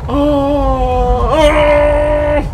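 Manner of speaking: high frightened yelp; startled cry. Real, not acted. Startled in a horror game.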